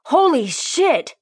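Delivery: high, drawn-out startled yell. Acted. Officer dropping to the ground, yelling in shock. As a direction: yell it as you hit the ground. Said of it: "he yelled".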